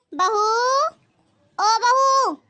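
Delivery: loud, high call across the house; mother-in-law summons her daughter-in-law loudly. Acted. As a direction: yell for the bahu from another room.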